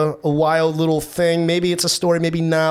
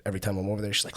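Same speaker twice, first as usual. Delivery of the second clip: ordinary delivery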